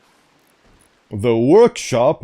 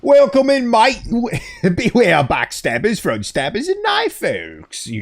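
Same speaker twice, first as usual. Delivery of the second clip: put-on botched London accent, more Australian; 11 semitones higher than usual. Streamer greeting a mate in a London accent gone Australian. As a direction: overdo a London accent until it slides into Australian. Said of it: "That's more Australian than London".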